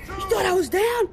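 loud, high trash-talk yell; taunting shout. Pretend. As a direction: roar the taunt like a boxer. Taunting opponent after getting up.

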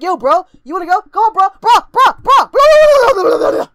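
loud, escalating bro taunts; mock fight challenge. Acting kid squaring up to fight.

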